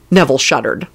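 ordinary delivery

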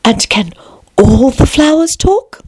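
high, tentative question; a small timid voice, drawn out at the end. A narrator's reading. Alice nervously asking the flowers, timid. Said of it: "in a timid voice, almost in a whisper".

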